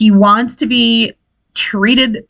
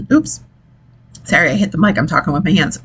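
usual speaking voice

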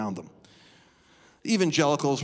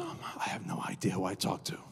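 hushed whisper of shock; whispered realization; 5 semitones lower, 9 dB quieter than usual. Retold or imitated. Pastor realizing on the phone, whispered. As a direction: let the words fall away into a breath. Whispered version then laughing version.

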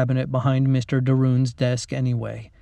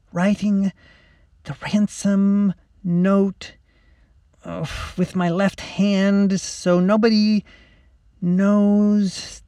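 put-on slow, halting, high-pitched girl's voice; drawn-out words between pen strokes; concentrating hard; 7 semitones higher, much slower than usual. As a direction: talk haltingly while absorbed in careful writing. Concentrating on writing left-handed.

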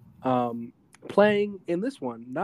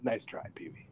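breathy whisper, much softer; 3 semitones lower, 10 dB quieter than usual. Retold or imitated. Mickey foiling Pee-wee's escape plan, whispered. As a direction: hush it under your breath. Voicing Mickey in retold scene.

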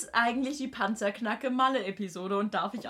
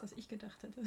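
whispering; 19 dB quieter than usual. Acted. Parody ends whispered.

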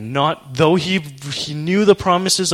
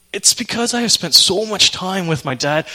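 usual speaking voice

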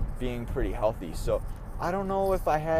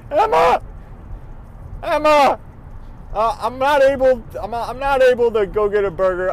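urgent calling out; 8 semitones higher, 6 dB louder than usual. Retold or imitated. Urgently messaging date after passing out.

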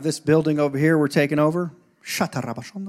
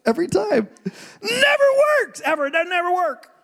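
much higher, fake whimpering; mock crying; 14 semitones higher than usual. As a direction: pretend to cry about the ticket. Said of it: "comic whimpering".

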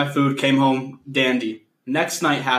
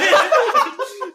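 big high laugh; 4 dB louder than usual. Real, not acted. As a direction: burst into a high, loud laugh. Laughs at the hunting story.

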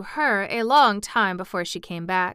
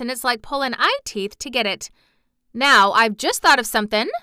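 excited; 4 semitones higher, 7 dB louder than usual. A narrator's reading.

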